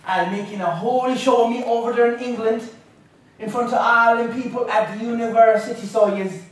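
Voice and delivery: put-on Irish-accented grandmother. Grandmother scolding him over his talk in England, Irish-accented disapproval. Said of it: "let's hear my grandmother now".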